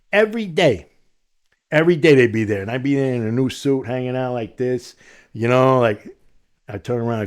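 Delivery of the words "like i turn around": ordinary talk, nothing marked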